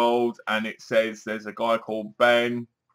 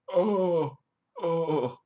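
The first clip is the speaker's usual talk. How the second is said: higher whimper fading to a breath; mock sob; 3 semitones higher, 5 dB quieter than usual. Reviewer pretending to weep over the double climax. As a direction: fake a sob over the ending.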